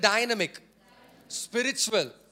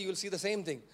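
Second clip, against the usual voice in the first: ordinary talk, nothing marked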